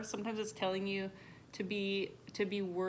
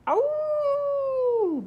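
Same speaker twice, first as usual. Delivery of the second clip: very high, loud yelp; puppy yelp; 10 dB louder than usual. Acted. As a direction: let out a sharp puppy yelp.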